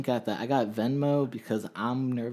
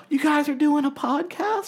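high, louder surprised mom imitation; 7 dB louder than usual. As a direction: play the amazed mother. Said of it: "She's like".

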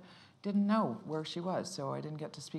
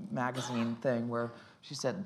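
usual speaking voice

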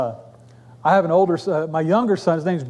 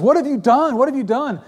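higher-pitched, urgent reproach; accusing prophet; 7 semitones higher than usual. Retold or imitated. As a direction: confront him as if appalled. Samuel confronts Saul, voiced.

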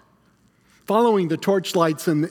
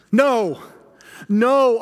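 raised, emphatic denial; crying out; 3 semitones higher, 3 dB louder than usual. Acted. Peter denies the woman's accusation, crying out. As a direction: cry it out to refute the charge. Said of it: "I cry out".

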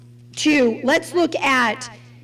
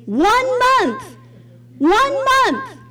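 one month, said twice higher each time; incredulous emphasis; 10 semitones higher, much slower than usual. Real, not acted. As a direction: stress each word, disbelief rising. Preacher repeats with rising outrage.

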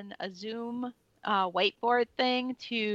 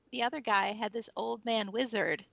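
ordinary talk

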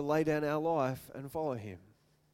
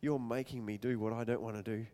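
low, much softer sulking grumble; resentful dog; 8 semitones lower, 8 dB quieter than usual. Pretend. Forced dog thinking of its owner, low soft resentful grumble. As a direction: seethe under your breath. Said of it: "its brain is just going".

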